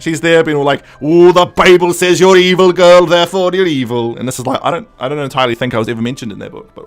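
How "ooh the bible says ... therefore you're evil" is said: hammed-up zealot's accusation, loud; mock fire-and-brimstone condemnation. Miss Tremaine condemning a girl with the Bible, hammed-up crazy zealot.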